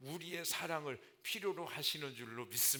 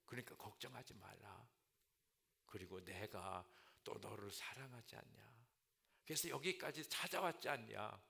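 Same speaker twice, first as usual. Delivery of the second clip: soft, gentle fatherly comfort; tender reassurance; 4 semitones lower, 5 dB quieter than usual. Acted. Jesus softly comforting fearful Peter, tender. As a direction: hush him like a worried child.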